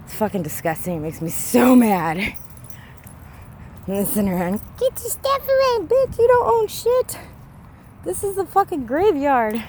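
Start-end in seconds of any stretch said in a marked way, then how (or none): 4.8-9.6 high, put-on screechy mock of a junkie. Mocking the junkies in the cemetery, high put-on yell. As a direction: sneer at them by parroting their yelling. Mimicking junkies yelling at people.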